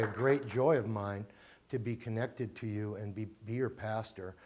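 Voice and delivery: usual speaking voice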